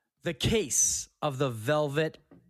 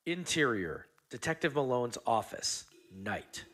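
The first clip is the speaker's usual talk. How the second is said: softer, lower noir scene-setting; noir narration; 6 semitones lower, 7 dB quieter than usual. Acted. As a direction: set the rainy night scene in a hush.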